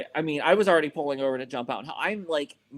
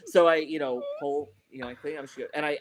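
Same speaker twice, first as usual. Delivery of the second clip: usual speaking voice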